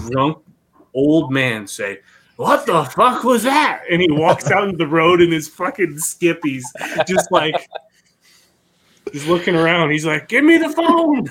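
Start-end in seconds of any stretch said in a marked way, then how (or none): 2.4-3.8 put-on drunk old man. Drunk old man voiced in story. 10.3-11.3 put-on squeaky high drunk old man blurting; high-pitched mock drunk demanding the phone. Trash man mimics drunk neighbour, high squeaky voice.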